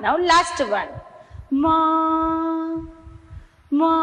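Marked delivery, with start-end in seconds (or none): 1.6-4.0 long mooing call; cow moo. Shown as a demonstration. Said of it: "I will make a sound of an animal".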